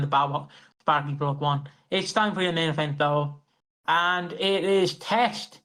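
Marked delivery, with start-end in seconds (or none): 3.9-5.6 slow announcement. Acted. Announcing main event slowly.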